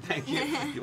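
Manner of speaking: high laughing thank-you. Real, not acted. To the interviewer who praised him, laughing thanks.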